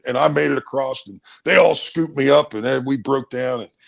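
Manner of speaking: ordinary delivery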